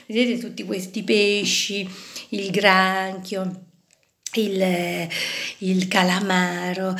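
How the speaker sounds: usual speaking voice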